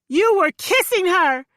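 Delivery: high, loud accusation; shouting. Acted. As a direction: yell the accusation. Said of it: "I shouted at him".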